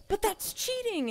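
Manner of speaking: put-on high indignant kid-show heroine; main character protest. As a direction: squeak out the protest like the plucky heroine. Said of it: "Allie, the main character, is like".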